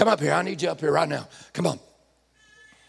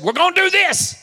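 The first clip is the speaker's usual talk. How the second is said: bossy king; 7 semitones higher than usual. Retold or imitated. King voiced deciding alone.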